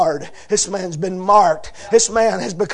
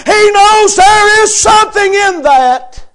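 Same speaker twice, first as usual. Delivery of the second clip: loud, high, drawn-out preaching cry; crying out the sermon climax; preaching at full force; 8 semitones higher, 7 dB louder than usual. Acted. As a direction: lift the congregation with rising fervor.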